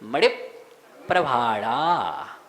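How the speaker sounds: मड़िप, a small break, then slow low प्रभाड़ा; unhurried. Shown as a demonstration. Teacher telling students to say it at ease, unhurried. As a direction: stretch the long vowels of प्रभाड़ा. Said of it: "आराम से बोलेंगे".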